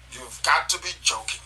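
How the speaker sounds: usual speaking voice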